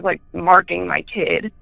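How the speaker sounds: usual speaking voice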